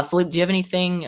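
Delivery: ordinary delivery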